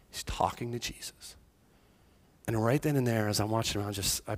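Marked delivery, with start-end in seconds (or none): 0.1-1.4 soft unvoiced repeat, full of wonder; awed whisper. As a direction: let it fall to a breath. Daughter explains his murmuring.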